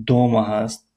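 ordinary delivery

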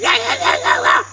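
imitated monkey jabber; a quick string of monkey yeses; monkey's affirmative chatter. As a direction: chatter yes as a monkey would. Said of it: "And the monkey went".

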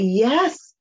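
normal talking voice

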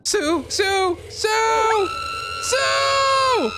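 high panicked shouting. Real, not acted. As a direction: scream her name rising. Calling a friend in panic.

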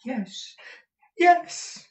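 high, eager yeses; excited high-pitched assent; Smike crying out. A narrator's reading. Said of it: "cried Smike".

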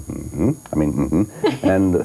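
ordinary delivery

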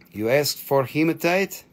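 ordinary talk

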